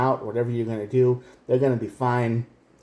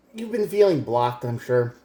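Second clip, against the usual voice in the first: normal talking voice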